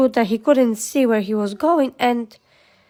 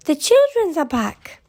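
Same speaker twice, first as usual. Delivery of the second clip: high, delighted calling out; bright-pitched, eager welcome; excited cry; 6 semitones higher than usual. A narrator's reading. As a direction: call the little ones in, pitch lifted. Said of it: "she cried".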